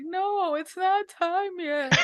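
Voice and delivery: a hint of a stubborn little boy; boy refusing to return the library book; son refusing. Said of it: "He was like".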